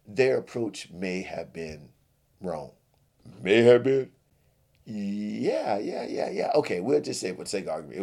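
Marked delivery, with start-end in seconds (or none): 3.4-4.1 loud, higher shocked echo; incredulous outburst. Acted. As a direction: throw the words back at him. Billy outraged at wording.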